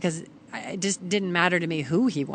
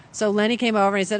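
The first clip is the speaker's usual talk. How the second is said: normal talking voice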